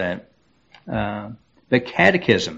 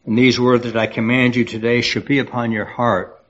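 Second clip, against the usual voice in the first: scripture reading. Acted. Pastor reads Deuteronomy aloud.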